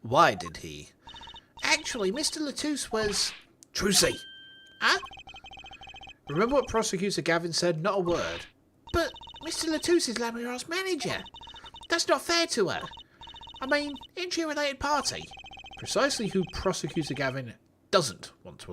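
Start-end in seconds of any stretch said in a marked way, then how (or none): 1.6-3.3 put-on high, chirpy Cockney girl voice; Cockney schoolgirl voice pitched way up. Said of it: "giving Trucy that high-pitched Cockney voice". 8.9-15.2 put-on high, chirpy Cockney girl voice; Cockney schoolgirl voice pitched way up. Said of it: "giving Trucy that high-pitched Cockney voice".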